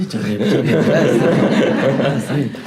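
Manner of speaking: slow, drawn-out mocking repeat; teasing a lazy excuse; mock lethargic echo. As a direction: echo his lame answer back at him slowly. Mocking the Cohen's lazy reply.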